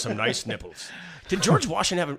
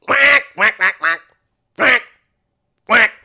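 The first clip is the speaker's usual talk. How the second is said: repeated duck quacks; 7 semitones higher, 11 dB louder, slower than usual. Shown as a demonstration. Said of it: "Take four".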